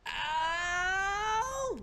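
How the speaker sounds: long, rising cry of pain; pained wail. Real, not acted. Hurt in the game.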